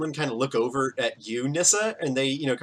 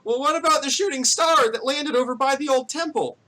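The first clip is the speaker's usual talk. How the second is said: high-pitched yell from the crowd; high, urgent townsperson shout; yelling villager; 12 semitones higher, 4 dB louder than usual. Acted. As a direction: call out a pressing question, high. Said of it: "another person kinda yells out".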